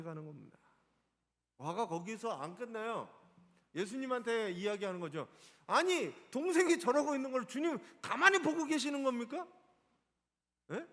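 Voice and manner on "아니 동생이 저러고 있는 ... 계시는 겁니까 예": higher and louder, demanding answers from the Lord; heated complaint, pitched up and pushed fast at the end; indignant accusation. Acted. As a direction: accuse the Lord of doing wrong, rising outrage. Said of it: "이렇게 얘기하는 거예요".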